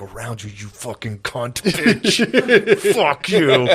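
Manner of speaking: slow, drawn-out mock cursing, laughing; imagined angry retort. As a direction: curse in pretend rage, breaking into laughter. Imagined angry retort, in his head.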